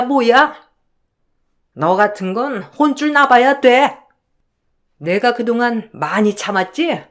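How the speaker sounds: put-on scolding older in-law voice, building in force; domineering mother-in-law laying down the law; indignant mother-in-law. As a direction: berate the daughter-in-law with mounting outrage. Mother-in-law berates daughter-in-law.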